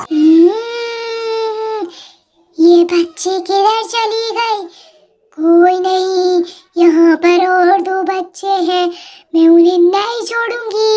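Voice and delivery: put-on loud, slow witch voice, hungry for children; a witch's loud, drawn-out threat; menacing witch. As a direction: boom it out slowly like a witch hunting her prey.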